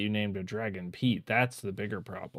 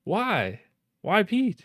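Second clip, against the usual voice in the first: incredulous; 11 semitones higher, 7 dB louder, slower than usual. Real, not acted. Disbelief at the answer.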